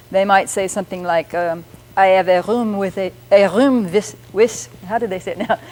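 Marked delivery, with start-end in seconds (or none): 2.0-4.2 mock French accent with French r. Shown as a demonstration. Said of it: "speaking English with a French accent". 4.3-4.8 French-accented WITH said as "wiss". Shown as a demonstration. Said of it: "the pronunciation wis for with".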